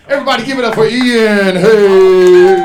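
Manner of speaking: loud, high crowd-rousing call; announcer shout. Real, not acted. Host bringing a comic up, loud high shout. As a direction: holler it and stretch the end.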